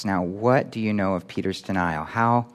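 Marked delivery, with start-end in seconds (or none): none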